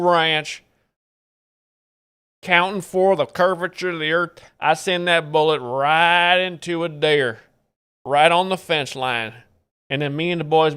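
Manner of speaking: boastful Texan rancher; Texas accent. Shown as a demonstration. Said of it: "that's a Texas accent".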